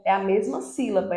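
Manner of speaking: ordinary talk, nothing marked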